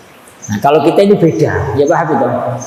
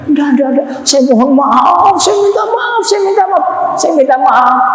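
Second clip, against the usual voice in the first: high, pleading cries of sorry; ashamed man pleading with the Imam; desperate apology; 9 semitones higher than usual. Acted. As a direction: beg forgiveness, voice pitched high. Man begs forgiveness in shame.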